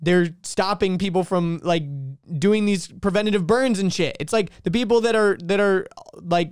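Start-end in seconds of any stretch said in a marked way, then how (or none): none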